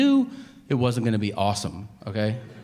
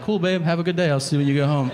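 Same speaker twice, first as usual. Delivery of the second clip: bright fake-cheerful brush-off; breezy sarcastic goodbye; sarcastic cheery; 4 semitones higher, 3 dB louder, faster than usual. Sarcastic reply to wife.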